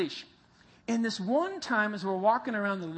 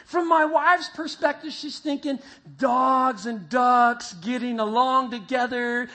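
ordinary talk

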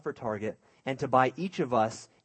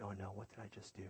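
very soft, lower, quick "uh-oh" voice; mock panic under the breath; hushed dread; 5 semitones lower, 22 dB quieter, faster than usual. Cousin on the bed realizing what she did, hushed and quick.

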